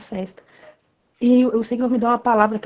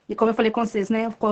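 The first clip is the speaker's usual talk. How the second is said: ordinary delivery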